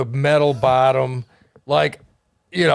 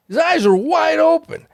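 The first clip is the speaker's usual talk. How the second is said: stunned, eyes wide; shocked emphasis; 13 semitones higher, 5 dB louder than usual. Real, not acted. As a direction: pitch jumps way up on open. Shocked emphasis, pitch jump.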